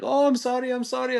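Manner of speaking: very high-pitched mock apology; high, hurried-to-apologize voice; flustered apology. Retold apology of startling woman.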